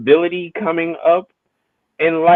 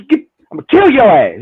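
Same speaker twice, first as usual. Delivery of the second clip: louder, higher mock threat to the son-in-law; 5 semitones higher, 7 dB louder than usual. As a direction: bark it like a furious dad.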